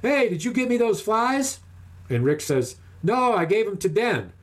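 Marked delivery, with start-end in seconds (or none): 0.0-1.6 high, drawn-out mock holler; slow, high-pitched long-distance call; retold yell upriver. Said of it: "So he yells upriver to Rick". 3.0-4.3 higher-pitched mock call back; acted long-distance reply, voice raised in pitch; retold shout back. Rick answers the yell from upriver.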